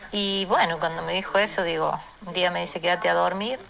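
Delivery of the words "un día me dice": ordinary delivery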